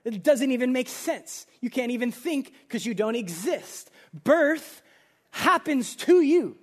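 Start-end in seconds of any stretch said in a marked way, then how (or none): 4.1-6.6 emphatic preaching. Acted. Preacher hammers key point.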